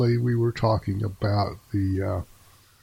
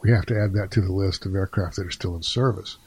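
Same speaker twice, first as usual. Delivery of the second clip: whispered aside; 3 semitones lower than usual. Retold or imitated. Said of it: "I said something, I think, to the effect that".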